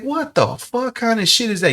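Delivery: high-pitched, incredulous outburst without extra volume; incredulous disgust. Retold or imitated. Reacting to a man hiding behind his girlfriend, incredulous. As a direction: pitch it way up in disbelief.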